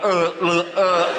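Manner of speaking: short, tense, mock-scared grunts; nervous grunts. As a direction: act out squirming fear at the doctor. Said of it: "like that, right?".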